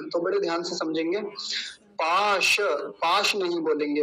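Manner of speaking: ordinary delivery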